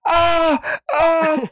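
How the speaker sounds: high, crying yell; retold yelling cry. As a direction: wail it out in terror. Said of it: "like, yelling".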